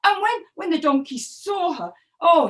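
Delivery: plain reading voice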